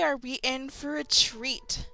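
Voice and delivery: ordinary delivery, nothing marked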